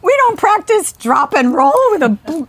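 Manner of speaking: put-on high-pitched, loud kid in alarm; retold childhood panic. Child alarmed that family has no fire plan, mock panic. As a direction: blurt it out like an overwhelmed, worried child.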